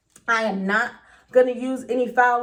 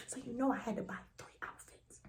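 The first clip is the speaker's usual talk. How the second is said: whispered aside; 15 dB quieter than usual. Real, not acted. Confiding to viewers about the outfits, whispered aside. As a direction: whisper it conspiratorially.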